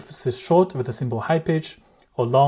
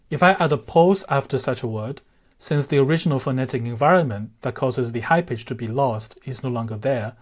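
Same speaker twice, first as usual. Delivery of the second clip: usual speaking voice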